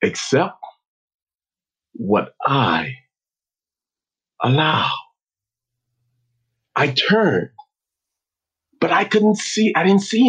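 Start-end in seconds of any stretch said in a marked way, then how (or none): 0.0-4.9 low, slow, spaced-out calm. Retold or imitated. Unseen voice speaks to player leaving field, calmly. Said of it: "It spoke so calmly and said".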